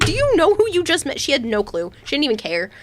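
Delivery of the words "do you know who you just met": incredulous exclamation. Retold or imitated. Incredulous at sister's reaction.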